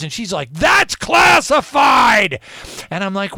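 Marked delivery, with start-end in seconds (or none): 0.5-2.4 furious, slow, much higher and louder outburst; angry shout. Retold or imitated. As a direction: bark it out like an outraged official. Fire shooting out of her eyes.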